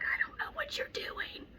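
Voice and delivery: hushed exasperated whisper. Retold or imitated. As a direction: breathe it out, barely voiced.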